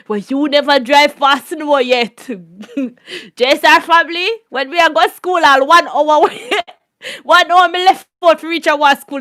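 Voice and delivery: ordinary talk, nothing marked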